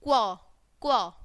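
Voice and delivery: क्व as "kwuh", blend plus short vowel; letter sound demo. Shown as a demonstration. Teacher showing the sound of Q, letter sound demo. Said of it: "क्या बोलेंगे".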